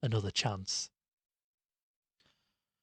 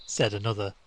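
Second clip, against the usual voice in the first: plain reading voice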